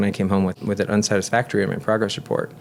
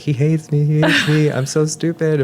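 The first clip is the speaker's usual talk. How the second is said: put-on whiny kid; 7 semitones higher, 3 dB louder than usual. Sulking child after being yelled at.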